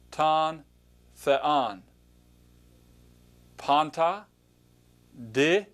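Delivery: slow word-by-word Greek pronunciation, each word set apart; reading Greek aloud. Shown as a demonstration. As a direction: pronounce each Greek word clearly for learners to repeat. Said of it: "point to the word and just pronounce it".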